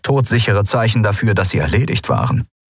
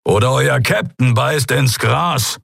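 threatening; 5 semitones higher, slower than usual. A narrator's reading. Keru threatens pirates.

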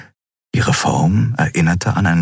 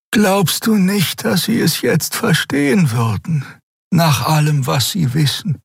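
pleading Xea; 12 semitones higher than usual. A narrator's reading. Xea voiced by narrator.

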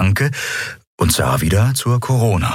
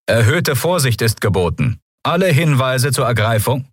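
higher-pitched official warning announcement; disembodied announcement voice; 7 semitones higher than usual. A narrator's reading. Patrol ship warns all citizens of pirates, disembodied announcement. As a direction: address the whole province as an official broadcast. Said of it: "lauschten der körperlosen Stimme".

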